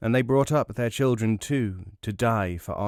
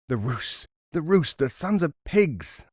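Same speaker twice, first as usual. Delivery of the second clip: angry outburst at the Rus, rising to a higher yell; furious shout; 5 semitones higher than usual. Acted. Cousin Lalbaz rages at the Russians in the bazaar, shouting. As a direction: spit out the name in fury, rising. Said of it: "Lalbaz was shouting now".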